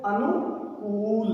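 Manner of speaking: अनुकूल stretched slowly, each sound clear; slow model word. Shown as a demonstration. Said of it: "ऐसे ही अनुकूल में देखो".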